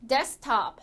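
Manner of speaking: 데스크탑 as English "des-top", no K; K-dropped English pronunciation. Shown as a demonstration. Teacher models after dropping K, English pronunciation. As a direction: say 데스크탑 in two beats, leaving out "keu". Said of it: "탈락이 되죠".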